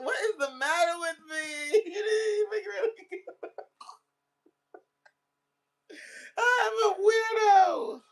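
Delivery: real teary laugh-crying, voice high and wobbling; laughing through sudden real tears; tearing up. Real, not acted. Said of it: "I'm really tearing up".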